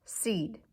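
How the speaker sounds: seed said quickly as one word; shrunk word. Shown as a demonstration. Teacher shrinks seed back for class, quick single word. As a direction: snap seed back together. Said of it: "One more time".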